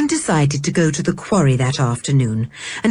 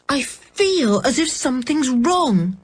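put-on obstinate girl; 7 semitones higher than usual. A narrator's reading. George voiced.

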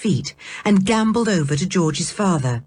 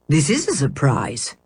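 a lower, frowning professor; lightly voiced absent-minded uncle; Uncle Quentin voice; 4 semitones lower than usual. A narrator's reading. Uncle greets the children with a slight frown, lower.

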